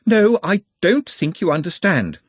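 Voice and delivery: high, loud, weary protest; exasperated. A narrator's reading. Ponder sighing at men who misunderstand the rules, exasperated. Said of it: "There was a sigh".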